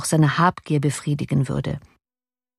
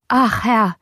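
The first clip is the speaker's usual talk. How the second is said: raised, eager call to his master; 5 semitones higher than usual. A narrator's reading. Servant bursting in to his master with news, calling out. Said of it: "rief der Diener".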